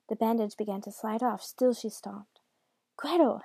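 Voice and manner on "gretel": high, loud call; shouted name. A narrator's reading. Brother seeing his sister attack father, shouting. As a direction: shout the name to break up a fight. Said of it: "Hansel shouted".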